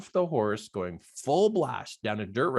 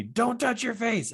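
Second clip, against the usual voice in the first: retold dad's yell; 11 semitones higher than usual. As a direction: imitate Dad calling out urgently, pitch raised. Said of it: "my dad yells to me".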